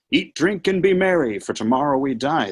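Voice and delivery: Satan parodying the adage, high and mocking; mocking quote of adage. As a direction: ham up the old proverb. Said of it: "the adage touts".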